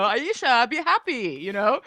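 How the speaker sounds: normal talking voice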